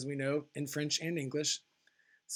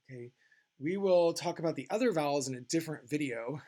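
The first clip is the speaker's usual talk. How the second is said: normal talking voice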